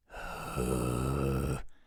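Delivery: long, heavy exhale; exasperated sigh. Acted. Exasperated before rebuking the prince.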